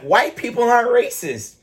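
high, prim mock Karen; hammed-up indignant Karen. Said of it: "she's like".

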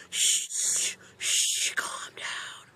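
whispered shush, gentle; soothing whisper. Retold or imitated. Kid whispering to calm the thrashing horse, soft shush. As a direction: hush the horse calmly.

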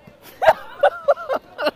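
high-pitched burst of laughter; loud high laugh. Real, not acted. Laughing at own overreaction, loud high cackle. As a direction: crack up loudly at yourself.

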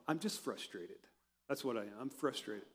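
low, muted mock protest; guy in denial. Every guy denying he is annoyed, muted protest. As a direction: play the guy insisting, voice dropped and soft. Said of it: "This is every guy I know".

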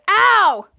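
loud high ow; cartoon zap pain; mock ow. Zapped during the fight, a loud ow. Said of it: "A one said".

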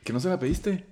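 high, loud disbelieving question; incredulous. Retold or imitated. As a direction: ask as if he's the fool. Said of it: "me dice". (incrédulo)

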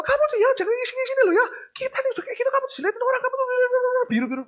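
very high, fast mock scolding mum; nagging mother. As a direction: scold the kids like a fussing mother. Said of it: "mau mama ngomel". (ibu mengomel, suara sangat tinggi dan cepat)

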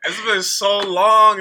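slow, loud, through laughter; laughing. Real, not acted. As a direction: stretch it out while still laughing. Laughing disbelief at old memory.

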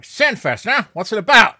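cigar in mouth, gruff exec; a hint of a cigar-chomping exec. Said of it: "here's me standing behind the desk with the cigar in my mouth like".

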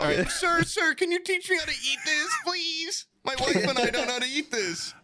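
very high, silly pleading voice; hammed-up polite begging; mock pleading diner. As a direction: impersonate a helpless customer, squeaky high. Plays friend begging strangers for help.

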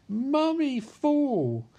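put-on high, singsong little-boy call; mock child's voice. Ghostly son looking down at his fallen mother, mock childlike. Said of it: "looks down at her and goes".

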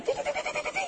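high rapid ti-ti-ti gunfire sound; gunfire imitation. Telling how the soldiers fired, rapid mock gunfire. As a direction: mimic a burst of shots.

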